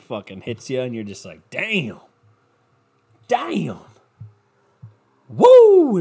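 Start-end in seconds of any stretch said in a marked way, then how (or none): 1.5-3.8 high, swooping exclamations; amazed reaction. Acted. Someone acting out a lemonade sugar rush, amazed. 5.3-6.0 loud, very high drawn-out whoop. Acted. Someone pumped up by a sugar rush, whooping.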